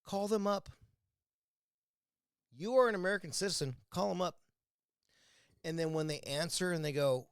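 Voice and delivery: ordinary talk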